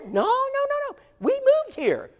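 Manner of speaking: high falsetto imitation of an excited lady; mock high woman's voice. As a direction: squeak it out like a delighted Southern lady. Brenda answering at the door.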